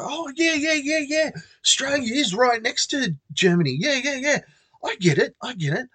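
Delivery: a hammed-up girl nodding along; eager girl. Imitating the girl buying the Germany story, high and eager.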